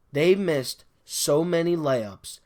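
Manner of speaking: normal talking voice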